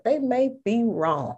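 ordinary talk, nothing marked

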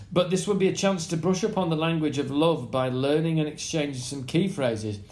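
usual speaking voice

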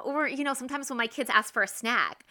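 ordinary delivery

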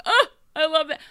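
high, loud delighted gush. Retold or imitated. Reacting to the episode, high and loud delight. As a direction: swoon over the line. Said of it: "And I'm like".

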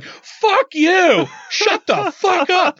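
high-pitched re-enacted shout; exasperated yelled outburst; angry yell. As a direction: holler it across a distance, drawing out the words. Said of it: "I yelled at her. I'm like".

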